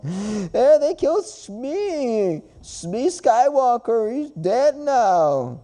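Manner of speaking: high, slow, drawn-out mock wail; hammed-up grieving fan, stretching every word; mock crying girlfriend. Mock girlfriend still wailing to therapist.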